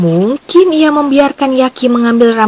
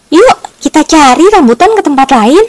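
louder, higher-pitched rallying call; eager invitation; 6 semitones higher, 5 dB louder than usual. A narrator's reading. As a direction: urge your friends along eagerly. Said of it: "Yaki mengajak teman-temannya".

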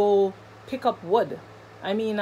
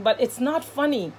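ordinary delivery

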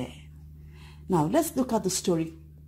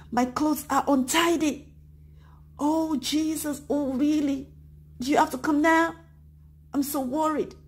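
worried; 7 semitones higher than usual. Acted. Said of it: "would you be worried?".

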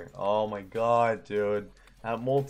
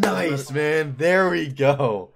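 louder, slow, savoring the win; 1 elated slow praise; 11 dB louder, slower than usual. Real, not acted. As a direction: drag out the celebration. Celebrating win.

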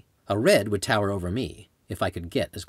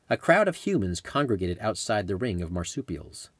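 ordinary delivery, nothing marked

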